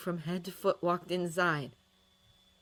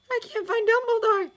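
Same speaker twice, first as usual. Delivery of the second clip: desperate; 10 dB louder than usual. A narrator's reading. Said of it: "sounding desperate".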